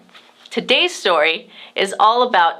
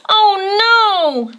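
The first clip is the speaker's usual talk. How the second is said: high alarmed oh no with a cry in it; 11 semitones higher, 3 dB louder than usual. A narrator's reading. A boy crying out as his kite flies off alone. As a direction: gasp it out as the kite escapes.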